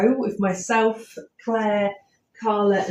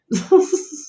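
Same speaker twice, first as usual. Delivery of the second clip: laugh; 8 semitones higher, 3 dB louder than usual. Real, not acted. Joke about the house.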